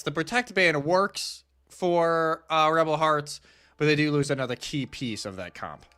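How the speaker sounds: ordinary talk, nothing marked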